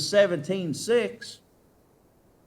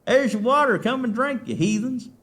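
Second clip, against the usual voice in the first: louder, higher scornful jeer; scornful Moses; 9 semitones higher, 5 dB louder than usual. Retold or imitated. Moses taunting the heathens with their water, scornful. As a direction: sneer the water at them. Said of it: "That's what Moses is saying".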